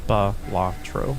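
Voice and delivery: Balatro slowly as "buh-LAH-tro", stressed on LAH; her own Balatro. Shown as a demonstration. Streamer decides to stick with her pronunciation. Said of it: "keep saying Balatro".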